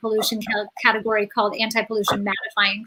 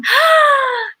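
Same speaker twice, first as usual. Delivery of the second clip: gasp; 14 semitones higher, 5 dB louder than usual. Real, not acted. Seeing the missing friend reappear.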